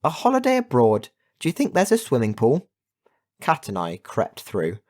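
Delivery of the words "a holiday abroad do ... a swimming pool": high, eager, bubbling delight; excited. A narrator's reading. Kat spotting a tropical jungle, excited. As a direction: chirp it with rising excitement.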